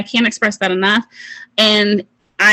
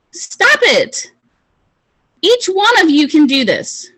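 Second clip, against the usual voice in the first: rally them, don't sit this out; fired-up urging; 8 semitones higher than usual. Real, not acted. To her sales team, fired-up urging.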